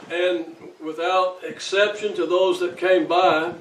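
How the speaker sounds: slow, loud pulpit delivery; preaching at full force. Real, not acted. Funeral eulogy at full force.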